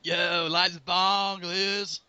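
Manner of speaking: loud, slow, stretched-out holler; mock stoner call. Joking as a character calling to Liz, slow loud holler. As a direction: drag the words out loudly as a joke.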